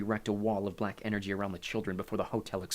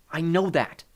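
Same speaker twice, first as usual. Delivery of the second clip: raised, higher voice, arguing back; impatient retort; 8 semitones higher, 8 dB louder, slower than usual. A narrator's reading. Nico answers his sister's warning, impatient and loud. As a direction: cut off a lecture impatiently.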